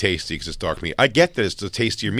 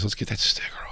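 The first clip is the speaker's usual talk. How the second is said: whispering; 8 dB quieter, faster than usual. Real, not acted. Sneaking the sticker off.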